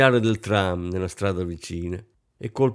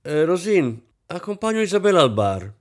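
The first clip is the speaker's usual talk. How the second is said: higher-pitched, lively announcement; exclaiming; 7 semitones higher, 4 dB louder than usual. A narrator's reading. Kampf tells Rosine he is taking Isabel off, exclaiming. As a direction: announce it cheerily to the room. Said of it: "Kampf esclamò".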